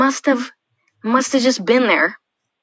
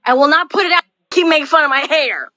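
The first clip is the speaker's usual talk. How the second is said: snapping back loudly at teasing; annoyed shout; 7 semitones higher, 5 dB louder than usual. Real, not acted. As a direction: shut them up, irritated and loud. Annoyed outburst at teasing friends.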